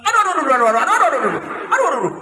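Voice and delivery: high squealing cries of pain; monkey crying out. Acted. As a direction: yelp like a hurt little monkey. Hit again, cries of pain. (jeritan monyet kesakitan, suara tinggi)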